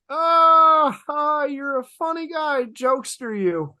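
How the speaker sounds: high, loud fake laugh, brushing it off; forced nervous laugh. Interns to creepy customer, high forced nervous laugh. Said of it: "we just are like".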